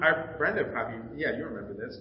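ordinary talk, nothing marked

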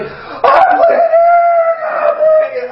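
high shrieking mock cry of a little boy; panicked child wail. Nephew panicking over a tiny cut, high mock wail. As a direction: wail like a panicking kid at a drop of blood. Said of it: "he lost it".

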